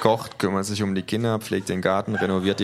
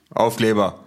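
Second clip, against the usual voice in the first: loud, higher, disbelieving repeat; incredulous echo; 4 semitones higher, 7 dB louder than usual. Acted. Official querying the stickers, loud and incredulous. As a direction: throw the word back, baffled and louder.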